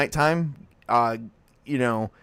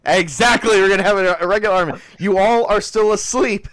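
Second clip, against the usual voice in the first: loud; 8 semitones higher, 10 dB louder than usual. Real, not acted. GM calls out to players.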